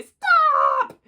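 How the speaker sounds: put-on high, long child's shriek; piercing storybook scream; very loud yell. A narrator's reading. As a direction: yell it out at full tilt. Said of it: "yelled very loudly".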